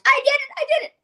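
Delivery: high, whimpering little victory cry; mock triumphant cry. Producer touching the snake, imitated in a high mock cry. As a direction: squeal it out like a scared man who just did it. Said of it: "he touches it and goes".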